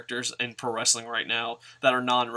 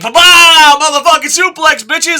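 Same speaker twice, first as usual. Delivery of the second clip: over-the-top wrestler yell; hyped wrestler; 17 dB louder than usual. Retold or imitated. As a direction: bellow it like a cocky heel. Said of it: "And Mac, like".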